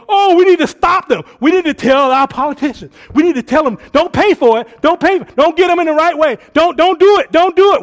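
loud, high, self-righteous ranting; mock finger-pointing rant. A preacher mocking soapbox Christians as a loud, high rant. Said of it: "point your finger at everybody and go".

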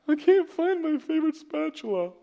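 high, put-on whiny child voice; milk the trivial disaster; melodramatic.

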